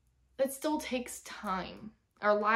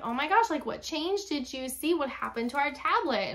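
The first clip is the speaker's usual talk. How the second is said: higher, animated classroom voice; put-on teacher enthusiasm; 6 semitones higher, 4 dB louder than usual. Teacher quizzing kids on the tablet for the camera, put-on enthusiasm. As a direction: act amazed for the kids. Acting her excited lesson for camera.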